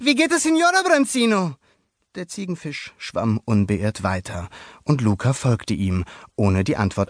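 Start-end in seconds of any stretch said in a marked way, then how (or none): 0.0-1.5 put-on high, loud boyish question; boy greeting. A narrator's reading. Luca greets a neighbor while swimming past, high voice. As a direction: toss the greeting off as young Luca.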